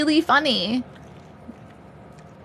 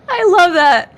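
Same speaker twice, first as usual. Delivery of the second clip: delighted; 6 semitones higher, 7 dB louder, slower than usual. Real, not acted. Delighted by comment.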